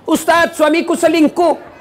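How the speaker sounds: high, slow complaining voice; complaining wife. Retold or imitated. Wife complaining to the ustaz, in a high, slow whine. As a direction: whine to the preacher about the husband.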